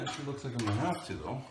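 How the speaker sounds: usual speaking voice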